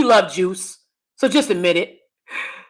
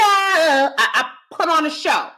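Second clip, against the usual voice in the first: usual speaking voice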